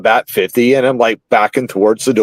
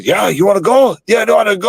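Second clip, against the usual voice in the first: mock tough guy; 8 semitones higher, faster than usual. Mocking the guy picking a fight.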